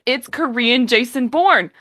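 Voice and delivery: emphatic verdict on the drama; emphatic declaration. Real, not acted. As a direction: announce it proudly. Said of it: "You know what?".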